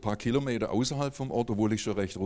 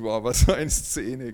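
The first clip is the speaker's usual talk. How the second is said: slow, louder amazed wow; 6 dB louder, slower than usual. Retold or imitated. Discovering the neighbour, slow amazed wonder. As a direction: marvel at the scene. Neighbour revealed behind camera.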